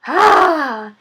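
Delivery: loud, high gasp after a gulp. Acted. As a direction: gasp from strong cider. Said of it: "gasped the smallest fox".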